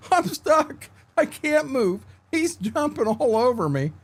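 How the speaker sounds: high, giggly complaint about being stuck; half-laughing, hammed-up helplessness; laughing mock distress. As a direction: laugh through it like you're helpless. Retelling being pinned, laughing.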